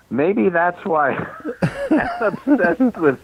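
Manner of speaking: words breaking up with laughter, pitch climbing; laughing. Real, not acted. Storyteller explaining why he's obsessed, laughing through it. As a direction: crack up helplessly while trying to finish. Cracks up at own conclusion.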